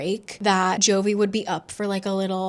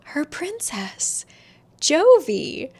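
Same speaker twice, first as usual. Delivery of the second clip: dramatic reveal; 6 semitones higher, much slower than usual. A narrator's reading. Dramatic reveal of the new queen.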